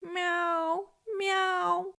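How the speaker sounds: high, drawn-out miaows of a lost kitten; desperate wail. A narrator's reading. Lost kitten wails for help, desperately miaowing. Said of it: "wailed desperately".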